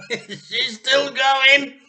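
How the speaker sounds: high, loud, slow, laughing; husband gleefully shouting at the shrinking. Acted. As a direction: laugh it out with glee. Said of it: "shouted Mister Cranky gleefully".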